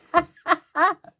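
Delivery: loud, high cackling laugh. Real, not acted. Grandma amused by her own silly accent, laughing loudly. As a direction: crack up at your own joke.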